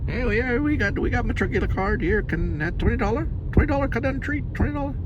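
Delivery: high mock Chinese accent, clipped broken English. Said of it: "Sounds like a Chinese person".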